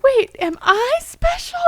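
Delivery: imitating a five-year-old, wide-eyed. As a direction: ask it like a surprised little kid. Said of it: "kind of like a five-year-old".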